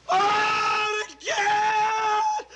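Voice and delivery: high, loud cry of horror; anguished cry. Acted. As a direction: shout in sudden anguish. Sudden sight in the fire.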